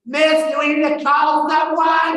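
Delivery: high, loud, slow woman's call; alarmed bystander lady. Retold or imitated. Lady at next table offers to call for help, high loud alarm. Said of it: "And this lady goes".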